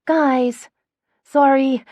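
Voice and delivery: loud, high apology breaking out between laughs; gasping through laughter. A narrator's reading. Gemma apologizing to Phoebe, gasping through laughter. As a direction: gasp it out while laughing hard. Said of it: "Gemma gasps out".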